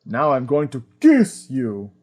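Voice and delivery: "kiss" punched out loud and overdone; hard stress on KISS. Retold or imitated. Host mimicking Farley Granger's line, exaggerated stress on "kiss". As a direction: imitate the actor, hitting "kiss" hard. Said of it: "emphasizes the word kiss so hard".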